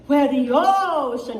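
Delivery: loud, high, drawn-out phrase; big, stretched-out teacher's model; resonant choir demo. Shown as a demonstration. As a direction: intone it slowly with full-body resonance. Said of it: "Feel that".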